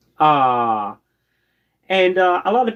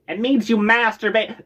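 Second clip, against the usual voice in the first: mimicked elderly woman; 6 semitones higher than usual. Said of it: "an elderly woman's like".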